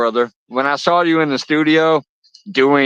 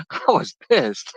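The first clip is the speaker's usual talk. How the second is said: laughing; 8 semitones higher, 5 dB quieter than usual. Real, not acted. Laughing through his story.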